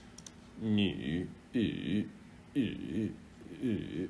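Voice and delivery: 女 and E low, dipping in the third tone. Shown as a demonstration. Teacher drilling the third tone, low froggy 女 and E. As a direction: croak 女 and each E down low. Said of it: "That's the third tone".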